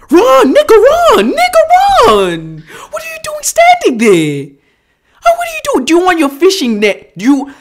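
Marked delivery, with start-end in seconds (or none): 0.1-2.6 high-pitched, loud yelling; frantic urging. Real, not acted. Viewer urging man to flee the gator, frantic yelling. 2.9-5.8 very high, incredulous shriek; exasperated yell. Real, not acted. Viewer yelling at man standing still near danger, exasperated.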